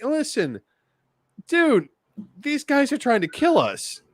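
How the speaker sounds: high-pitched acted plea; mock pleading. Arguing with the Archbishop's message of love, high mock plea.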